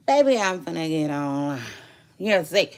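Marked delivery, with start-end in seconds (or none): none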